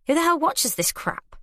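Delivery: can't believe this rubbish; exasperated. Acted. Narrator exasperated at trashy TV.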